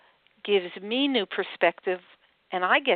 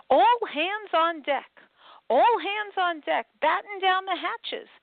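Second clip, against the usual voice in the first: high, slow booming pirate roar; loudest roar; 10 semitones higher, slower than usual. Acted. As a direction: bellow the order across the deck. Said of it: "used his best and loudest roar".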